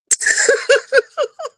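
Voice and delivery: high burst of laughter; short laugh. Real, not acted. Woman laughing at her constipation story, high laugh. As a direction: laugh at how absurd it is.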